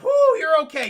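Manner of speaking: high, very loud relieved cheer; relieved whoop. Retold or imitated. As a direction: whoop that the kid is alive. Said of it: "he's like".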